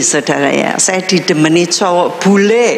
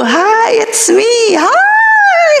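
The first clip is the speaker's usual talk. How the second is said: excited greeting; 14 semitones higher, much slower than usual. Retold or imitated. Girl answering her boyfriend's phone call, excited high squeal. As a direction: squeal it like you missed him.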